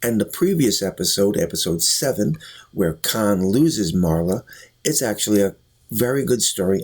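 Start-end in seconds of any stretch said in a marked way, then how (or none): none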